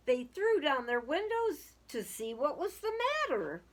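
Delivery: lively storybook reading aloud; sing-song children's story voice. A narrator's reading. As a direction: read it like a rhyming picture book to kids. Reading a picture book aloud.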